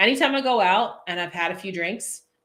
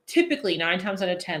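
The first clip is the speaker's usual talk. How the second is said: ordinary talk, nothing marked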